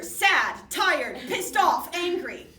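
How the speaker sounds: loud, high imitation of game-night guessers; punchy mock shouts, each word hit hard; rapid-fire guesses called out. Imitating friends calling out guesses.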